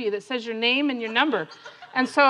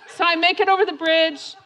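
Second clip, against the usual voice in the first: normal talking voice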